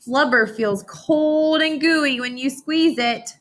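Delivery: slow, savoring read of cold gooey; relishing the words. Acted. Teacher making the class feel the flubber, slow and savoring. Reading a sensory line to kids.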